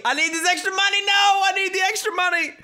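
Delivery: high, rising, whiny mock plea building to a shout; mock pleading. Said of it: "Look at Danger pleading, saying".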